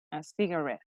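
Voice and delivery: "cigarette" softly repeated, short e in ret; correct pronunciation. Shown as a demonstration. Teacher repeating the corrected word, correct pronunciation. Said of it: "it's cigarette".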